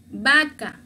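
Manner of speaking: "Vaca" said with a b sound, BA-ka; V read as B. Shown as a demonstration. Teacher showing how V is read in Spanish, V as B. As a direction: open "Vaca" on a firm b. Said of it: "se dice como la B".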